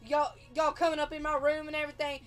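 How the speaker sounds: loud, high, angry shouting; guy screaming. Retold or imitated. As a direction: yell it at the whole hall, furious. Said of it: "we hear this guy screaming".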